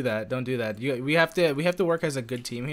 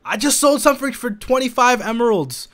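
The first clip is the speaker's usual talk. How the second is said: high, loud burst of delight; excited bragging; 9 semitones higher, 7 dB louder than usual. Real, not acted. Player boasts to rival of a sale, excited bragging. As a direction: crow about the windfall.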